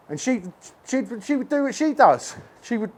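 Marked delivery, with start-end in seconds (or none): none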